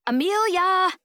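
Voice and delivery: loud, high call to get attention; shouting a name. Acted. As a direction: yell her name to break the stare. Friend shouting to snap her out.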